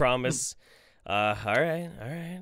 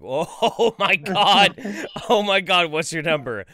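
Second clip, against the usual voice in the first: amazed swooning reaction; 5 semitones higher, 5 dB louder than usual. Acted. Acted amazed viewer, laughing.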